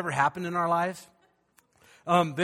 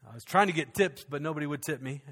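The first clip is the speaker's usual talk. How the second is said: ordinary talk, nothing marked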